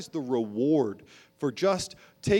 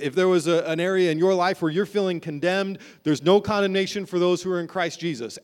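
usual speaking voice

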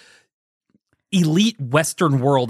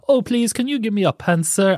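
high, prissy mock-British plea; lightly hammed English politeness; mock polite request; 10 semitones higher than usual. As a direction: play a fussy genteel asker, pitched way up.

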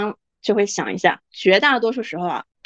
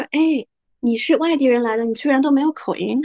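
higher-pitched mock amazement at an outsider; imitated surprise; 5 semitones higher than usual. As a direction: act out someone amazed by a newcomer. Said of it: "这个惊奇的程度". (模仿别人惊奇的语气)

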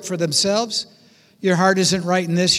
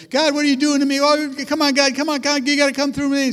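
higher, louder desperate pleading to God; panicked leaders' plea; 6 semitones higher, 3 dB louder, faster than usual. Retold or imitated. Leaders crying out to God, higher, louder and frantic. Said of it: "the leaders go".

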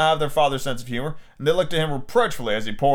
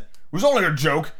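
ordinary delivery, nothing marked

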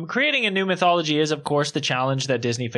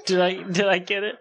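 ordinary talk

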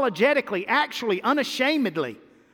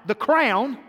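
ordinary talk

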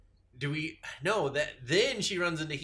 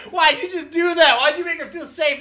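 high, loud incredulous outburst; indignant mock outrage; 12 semitones higher, 11 dB louder than usual. Friend reacting to the movie scene with loud mock outrage. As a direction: protest at the betrayal in high disbelief. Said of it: "I was like".

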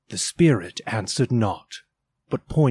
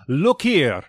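loud, higher look here with the weight on here; exclaiming ghost; 6 semitones higher, 5 dB louder than usual. Acted. Ghost shows Scrooge the children, loud exclamation. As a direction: insist he looks. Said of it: "exclaimed the ghost".

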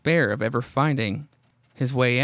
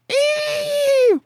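very high, loud mock shriek; horror-comic shriek as the horse strikes; shrill scream; 5 dB louder than usual. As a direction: let out a piercing scream. Greg attacked by the horse.

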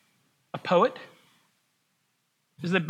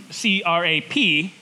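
professor's voice, spelled out; 3 semitones higher than usual. Retold or imitated. Professor spelling out a rude word to his class, booming. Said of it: "and he talked like this".

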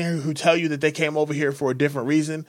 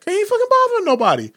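much higher, fast, heated defense; emphatic retort; 3 dB louder, faster than usual. Retold or imitated. Security head defending the bar regular, high and fast. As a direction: fire it back fast.

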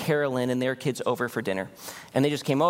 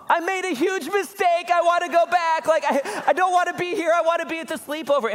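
very high, mock-wailing little boy voice; hammed-up imitation of a kid sobbing with regret; sobbing child; 4 dB louder than usual. Son waking his parents, regretting leaving the sleepover, sobbing. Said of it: "Judah sobbing again".

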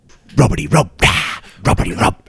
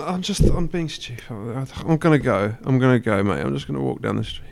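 put-on embarrassed drunken mumble; 11 semitones lower, 10 dB quieter than usual.

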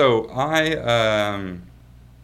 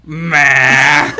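loud, drawn-out dismissive noise; 2 semitones higher, 13 dB louder than usual. Retold or imitated. Half-awake groom to raiding police, loudly dismissive. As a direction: wave them off with one big noise.

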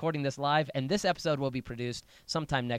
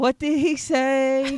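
imitating the sassy squeal, high and slow; 11 semitones higher, 9 dB louder, much slower than usual. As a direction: copy the sassy disbelief, louder. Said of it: "that's a strange thing that you just did".

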